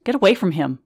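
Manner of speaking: wife ordering the strange priest away; sharp. Retold or imitated. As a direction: snap at the stranger to back off. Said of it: "I say sharply".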